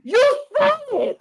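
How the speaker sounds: very high, stammering squeal; short-circuit disbelief. Retold or imitated. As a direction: sputter in high-pitched shock. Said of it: "he was like".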